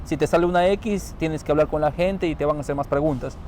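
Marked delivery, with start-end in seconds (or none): none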